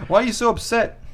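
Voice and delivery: high, put-on bewildered voice; baffled son. Clueless son to the mom he insulted, mock bewilderment. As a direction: protest in a high mock whine.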